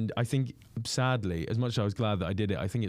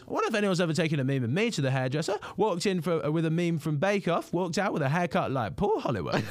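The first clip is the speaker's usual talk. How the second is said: quoting the comedian; 7 semitones higher than usual. Retold or imitated. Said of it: "and then he goes".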